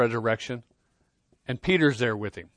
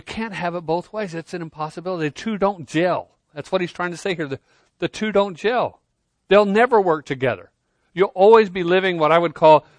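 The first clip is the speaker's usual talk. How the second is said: preaching at full force; 5 semitones higher, 6 dB louder than usual. Acted. Preaching emphasis.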